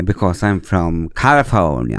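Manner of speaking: slow, exaggerated Californian English; mock American self-introduction, slow; fake American accent. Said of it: "Jeg pleier å si sånn".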